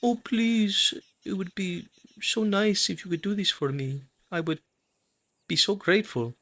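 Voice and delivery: high, sweet begging voice; flirty pleading. Acted. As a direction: bat your eyes and plead sweetly. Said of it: "pull your hair behind your ear and say".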